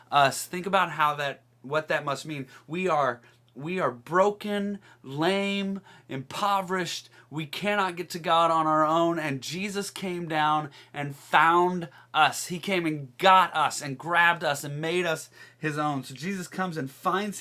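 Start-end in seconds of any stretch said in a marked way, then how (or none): 2.7-14.6 building sermon. Acted. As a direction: preach it, lift each word up. Preaching climax.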